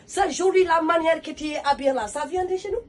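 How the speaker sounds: a hint of a warm Senegalese mom; Senegalese mother. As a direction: restaurant owner admiring her outfit. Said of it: "la maman sénégalaise".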